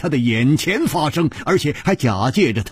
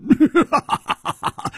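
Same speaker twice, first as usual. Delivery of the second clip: hearty laugh. Acted. Hu Tiehua seeing his friend alive, laughing with joy. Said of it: "大笑着说".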